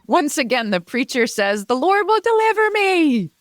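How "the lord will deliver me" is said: much higher, fervent preacher's proclamation; preacher's cry. Retold or imitated. Preacher in the flood trusting God, high fervent cry. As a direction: call on the Lord with blind faith.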